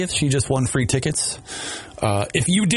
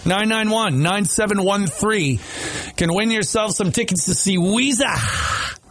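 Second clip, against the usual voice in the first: upbeat DJ contest announcement; radio contest promo; 4 semitones higher than usual. Real, not acted. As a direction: call out the winning caller number.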